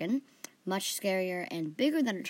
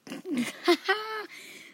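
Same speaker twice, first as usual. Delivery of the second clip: high burst of laughter escaping; fail to stifle a laugh, then burst out; suppressed laugh breaking loose; 11 semitones higher than usual. A narrator's reading. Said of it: "so we didn't laugh".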